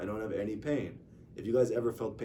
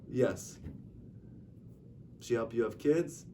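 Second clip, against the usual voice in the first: ordinary delivery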